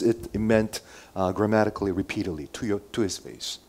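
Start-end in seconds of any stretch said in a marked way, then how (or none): none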